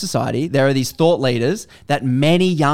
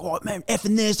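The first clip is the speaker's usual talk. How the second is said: mock aggro local; 6 semitones higher, 7 dB quieter than usual. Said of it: "swearing at people on the waves".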